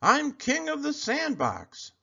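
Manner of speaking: high, slow bully boast. A narrator's reading. Bully Sammy boasting to Kevin in the sandbox. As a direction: lord it over the sandbox.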